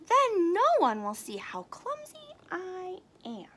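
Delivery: high little bat voice; Stellaluna voice. Acted. Stellaluna hides her clumsiness, high voice. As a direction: say it like a shy young bat.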